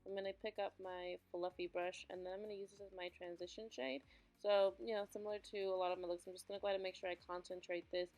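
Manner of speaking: soft, even tutorial voiceover; voiceover mode. Acted. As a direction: narrate the steps calmly to camera. Voiceover narration of makeup.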